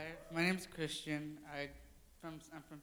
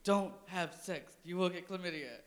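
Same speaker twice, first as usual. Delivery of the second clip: put-on Mean Girls coach; 6 dB louder than usual. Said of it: "in Mean Girls, where they're all like".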